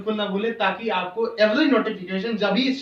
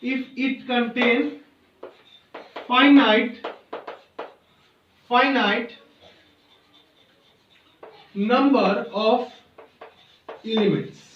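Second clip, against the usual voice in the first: slow dictation; much slower than usual. Acted. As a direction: dictate it word by word, repeating phrases. Dictating a definition to students.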